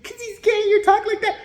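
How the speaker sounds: high, loud mocking quote; exaggerated high-pitched mock protest; mock objection. Mimics an imagined objector's assumption.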